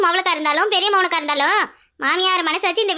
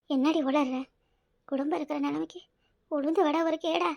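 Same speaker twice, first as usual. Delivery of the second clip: dejected; 2 semitones lower, 9 dB quieter than usual. Acted. Mother-in-law asked to cook amid a family crisis, dejected. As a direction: reproach her quietly, worn down by trouble.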